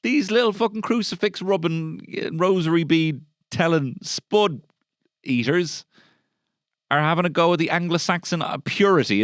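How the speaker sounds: high, loud, sneering mock rant; mock outrage. Comedian parodies an old anti-Irish text in a high loud mock outrage. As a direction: splutter like an offended bigot.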